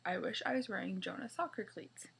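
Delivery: ordinary delivery, nothing marked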